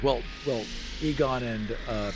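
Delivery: ordinary delivery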